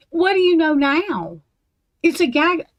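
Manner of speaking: mocking. Real, not acted. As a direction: throw the question back with scorn.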